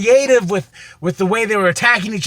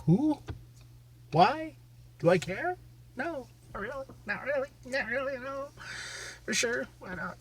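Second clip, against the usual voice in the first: soft high unimpressed asides; dismissive mutter; 3 semitones higher, 16 dB quieter than usual. Retold or imitated. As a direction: shrug it off quietly. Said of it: "I'm like".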